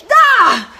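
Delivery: loud, high startled cry; imitating a triggered client's shriek. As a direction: cry out as if stung. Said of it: "totally triggered".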